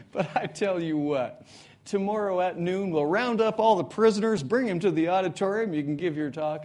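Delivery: loud and rising, like a gleeful jailer; jailer's booming announcement. Retold or imitated. Jailer tells the sick prisoner his wish is granted, booming loudly.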